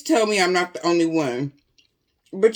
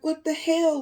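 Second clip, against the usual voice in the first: high startled exclamation; retold shock; 7 semitones higher than usual. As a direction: gasp it out in disbelief. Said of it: "I'm like".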